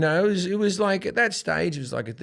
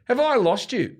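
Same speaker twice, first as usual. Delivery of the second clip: higher-pitched appeal to an audience; challenge to the crowd; 10 semitones higher than usual. Retold or imitated. Comic retelling his appeal to the studio crowd, rallying challenge. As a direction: throw it out high to the crowd.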